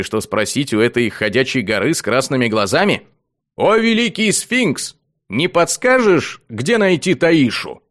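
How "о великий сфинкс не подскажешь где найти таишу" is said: slow, high pompous appeal; mock grand address. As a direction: petition a giant with mock ceremony. Hero addresses sphinx grandly.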